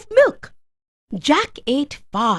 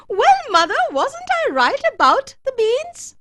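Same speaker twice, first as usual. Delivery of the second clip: put-on high, pleased boyish voice; triumphant; 10 semitones higher than usual. A narrator's reading. Jack shows his mother the gold, triumphant. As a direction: crow that you were right.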